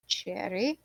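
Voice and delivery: soft, unsure naming of the creature; quiet questioning NPC. Acted. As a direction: ask gently, barely voiced. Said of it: "and goes".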